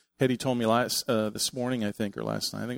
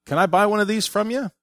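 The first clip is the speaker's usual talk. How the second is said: high, louder playful ask; teasing Rick; 8 semitones higher, 6 dB louder than usual. Retold or imitated. Friend Rick teasing the girl about buying her sculpture. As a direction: tease the girl with a fake deal. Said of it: "Rick was teasing Jessica and said".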